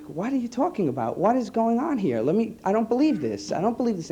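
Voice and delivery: like you can't take in what you just heard; stunned disbelief. Retold or imitated. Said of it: "and I was like".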